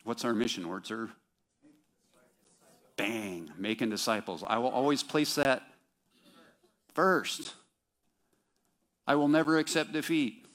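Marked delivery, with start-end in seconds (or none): 4.4-10.5 loud, high ringing oath; proclaiming creed. Retold or imitated. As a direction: call it out like a soldier's vow. Reciting creed, louder, higher.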